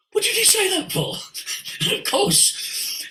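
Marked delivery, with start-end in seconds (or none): 0.1-1.2 much higher, fast, alarmed inner voice; panicked inner voice. Retold or imitated. His mind scolding him after the bankers' talk, high and fast. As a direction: blurt it like your own mind panicking. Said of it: "my mind said".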